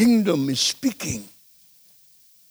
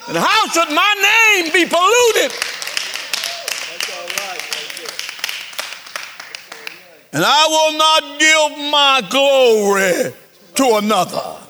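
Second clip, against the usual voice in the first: much higher, loud booming divine voice; God speaking; 8 semitones higher, 3 dB louder than usual. Acted. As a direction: thunder it out as God. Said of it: "God said".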